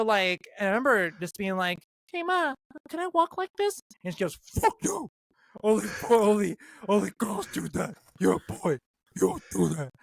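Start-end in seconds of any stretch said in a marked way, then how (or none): 2.1-3.8 high, put-on little-kid voice asking sweetly; kid self. Boy asking his mom in Walmart, in a high kid voice. 4.6-9.9 a lightly voiced scolding mother; mom's deep voice. Mom scolding her son in Walmart, mock gruff voice. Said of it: "such a deep voice".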